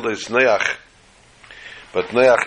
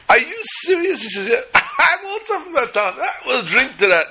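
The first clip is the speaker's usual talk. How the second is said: high, giggly tipsy voice, much higher; drunk man. Acted. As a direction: play a merry drunk, laughing through the words. Drunk twins toasting in joke.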